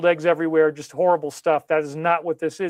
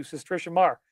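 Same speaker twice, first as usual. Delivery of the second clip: normal talking voice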